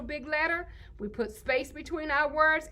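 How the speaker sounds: ordinary delivery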